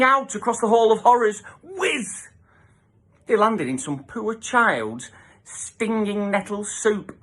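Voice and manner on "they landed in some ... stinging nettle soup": ordinary delivery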